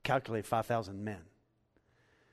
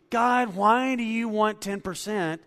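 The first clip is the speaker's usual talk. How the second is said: loud, high, slow whiny objection; mock a stingy giver's grumble; complaining giver voiced by the preacher; grudging complaint; 10 semitones higher, 10 dB louder, slower than usual. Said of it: "they don't do this".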